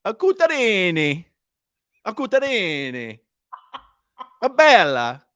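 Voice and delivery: mock Italian flourish; Italian accent. Hammed-up Italian accent on request from friend. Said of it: "do it in an Italian accent".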